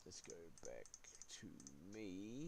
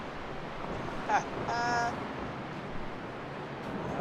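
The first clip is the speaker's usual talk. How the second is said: breathy laugh; 9 semitones higher than usual. Real, not acted. Laughs at his own crash.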